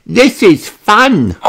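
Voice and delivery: put-on high, slow little girl voice; Peppa crying out. A narrator's reading. Peppa tells Mister Rabbit the name, high and slow. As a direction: blurt it out like an eager piglet. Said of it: "says Peppa".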